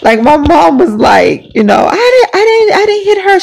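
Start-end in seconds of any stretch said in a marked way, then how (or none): 1.9-3.4 very high, protesting mom voice; defensive mom. Retold or imitated. Mom protests to grandmother that she didn't hit her. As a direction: deny it like a flustered mother.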